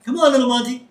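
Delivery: high coaxing voice to a puppy; coaxing Burns. Retold or imitated. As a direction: sweet-talk the dog up high.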